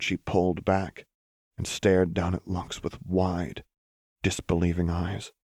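ordinary delivery

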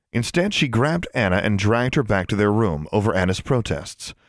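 usual speaking voice

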